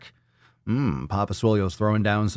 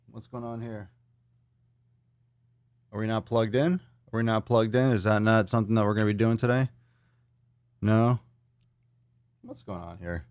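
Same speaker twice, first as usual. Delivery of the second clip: far from the mic; 4 dB quieter than usual. Real, not acted. Camera trouble, away from mic.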